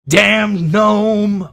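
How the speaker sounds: high, loud yelled curse; distant orc curse. Acted. An orc cursing the fleeing gnome from afar, loud. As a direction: shout it after someone riding away.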